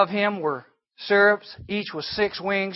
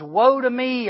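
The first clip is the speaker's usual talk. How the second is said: higher, louder anguished cry; cried out; 7 semitones higher, 5 dB louder, slower than usual. Retold or imitated. Isaiah crying out before the Lord, anguished and loud. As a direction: cry it out in dismay. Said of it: "I cried".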